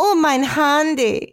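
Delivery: slow, drawn-out German accent; mock German, stretched and slow. Shown as a demonstration. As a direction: try out a hammed-up German accent. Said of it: "Yeah, she's German".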